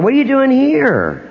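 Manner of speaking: high, startled airport question; surprised stranger. Retold or imitated. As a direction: gasp it out like you spotted a celebrity. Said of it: "I'm in the airport".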